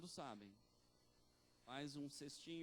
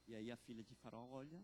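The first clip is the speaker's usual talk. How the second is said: normal talking voice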